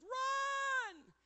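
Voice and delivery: sudden high yelled Run; retold scream. Miner warning his crew in the mine, a high scream. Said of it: "I screamed".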